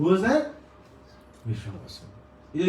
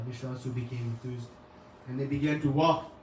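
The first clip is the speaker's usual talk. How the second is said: ordinary delivery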